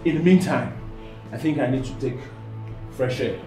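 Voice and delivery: very low, soft, halting; quiet, broken by pauses; subdued excuse. Acted. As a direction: slip away with a hushed, deep voice. Cornered man excuses himself.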